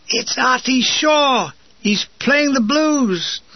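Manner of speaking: high, slow, drawn-out announcement; delighted exclamation. A narrator's reading. Wireless operator finding jazz on the dial, delighted. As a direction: draw it out in delight.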